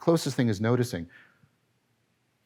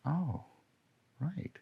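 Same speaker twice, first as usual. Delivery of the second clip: ordinary delivery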